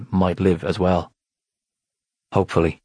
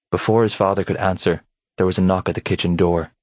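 ordinary delivery

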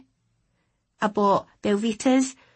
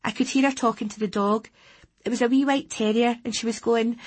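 ordinary delivery

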